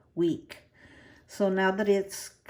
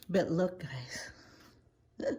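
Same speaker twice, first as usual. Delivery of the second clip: whispering; 6 dB quieter, much slower than usual. Real, not acted. Showing the plant excitedly.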